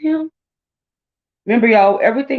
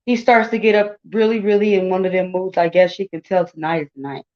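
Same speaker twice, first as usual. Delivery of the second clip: usual speaking voice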